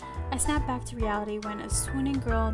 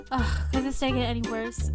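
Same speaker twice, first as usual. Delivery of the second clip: sighing, fed-up complaint; exasperated sigh; 4 dB louder than usual. Acted. Heroine dragging her feet after bad day, exasperated. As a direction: groan it out with mild exasperation. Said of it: "a loud sigh escapes me".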